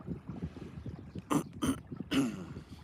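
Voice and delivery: quiet, repeated throat-clearing; soft ahem to clear congestion. Real, not acted. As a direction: clear the throat a few times. Clears throat before apologizing.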